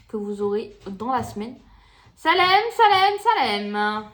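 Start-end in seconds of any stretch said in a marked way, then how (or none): 2.1-4.0 high loud call to a pet; sing-song call, Salem; calling the cat. Real, not acted. Calls the cat.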